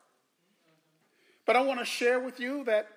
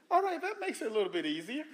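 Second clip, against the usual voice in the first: laughing; 4 semitones higher, 5 dB quieter, much faster than usual. Real, not acted. Relieved aside with laugh.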